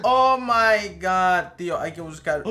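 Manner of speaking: normal talking voice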